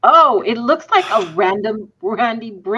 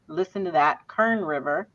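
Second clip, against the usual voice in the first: usual speaking voice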